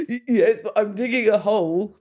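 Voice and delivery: high, loud, flustered insistence; frantic retort. Retold or imitated. Frantic reply to brother on phone.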